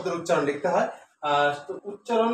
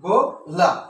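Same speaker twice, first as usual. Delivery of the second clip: গোলাপ louder, in two separate beats; গোলাপ broken after its first syllable; split into syllables; 5 dB louder, much slower than usual. Shown as a demonstration. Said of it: "লাভটা একসাথে উচ্চারণ করতে হয়".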